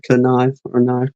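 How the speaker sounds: "knife" with the K sounded, then silent. Shown as a demonstration. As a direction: say "knife" both ways, K-nife or nife. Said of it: "It could be like a knife situation".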